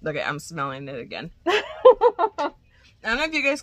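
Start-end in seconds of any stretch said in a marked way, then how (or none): 1.5-3.6 high, loud giggle; burst of laughter. Real, not acted. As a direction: laugh at yourself. Laughs at herself sniffing.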